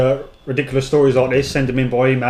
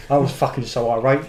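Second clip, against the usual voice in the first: normal talking voice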